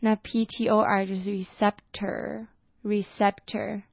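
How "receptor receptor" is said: "receptor" slowly, short E in "re" and in "cep"; teacher modelling "receptor"; careful classroom "receptor"; slow model pronunciation. Shown as a demonstration. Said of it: "R E的E呢，是发短音E".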